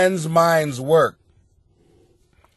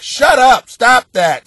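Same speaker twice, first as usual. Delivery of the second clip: angry shout; 5 semitones higher, 7 dB louder, slower than usual. Real, not acted. Scolding letter writer.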